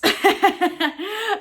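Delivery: loud high laugh. Real, not acted. Vlogger laughing at an early Christmas wish. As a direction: burst out laughing, delighted.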